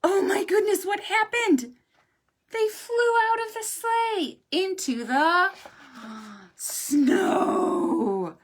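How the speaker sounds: high, loud gasp of surprise; wide-eyed storybook surprise; surprised. Acted. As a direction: react to the tumble in shock. Reader reacts to crash.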